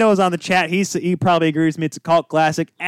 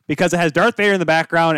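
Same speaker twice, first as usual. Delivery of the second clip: normal talking voice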